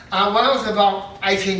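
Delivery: normal talking voice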